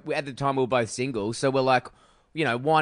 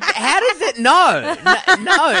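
high, slow, laughing; laughing incredulous; 13 semitones higher, 9 dB louder, much slower than usual. Retold or imitated. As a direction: laugh it out in disbelief. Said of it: "I was like".

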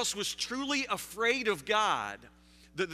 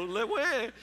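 higher mock baby wail in French; French-accented baby cry; 3 semitones higher than usual. Preacher repeats his guess for laughs, mock French-accented baby cry. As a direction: imitate a French newborn's "wah". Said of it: "something like that".

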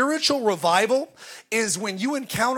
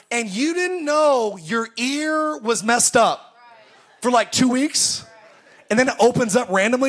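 usual speaking voice

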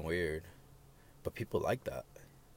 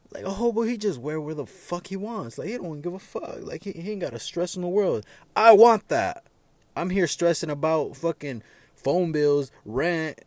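worked-up longing; 7 semitones higher, 5 dB louder than usual. Real, not acted. Envious rant about hobo life.

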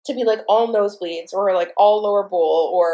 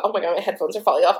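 ordinary talk, nothing marked

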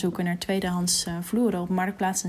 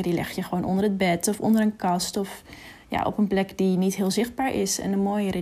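ordinary delivery

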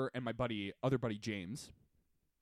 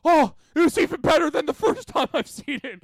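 much higher, louder gushing; excited friend; 12 semitones higher, 11 dB louder than usual. Retold or imitated. Friend after the movie raving, excitedly higher. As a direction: gush like you loved it. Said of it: "Ollie goes like".

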